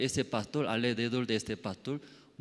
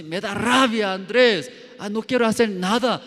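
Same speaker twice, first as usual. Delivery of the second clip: frustrated outburst; 9 semitones higher, 10 dB louder than usual. Retold or imitated. Voicing his own frustrated complaint.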